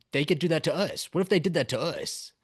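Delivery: mock alarmed Guardian; panicked realization. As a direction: voice the sudden fear that it could be them.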